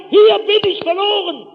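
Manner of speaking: high, loud cry for rescue; desperate shout. Retold or imitated. Lost walker crying out to rescuers, high shout. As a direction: shout out so searchers can find you. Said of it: "Ich konnte noch schreien".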